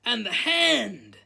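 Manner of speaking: high, loud, slow exclamation; pupil's eager, drawn-out boast; excited addition. A narrator's reading. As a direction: chime in, stretching the last word. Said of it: "said Dean".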